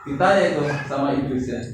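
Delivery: normal talking voice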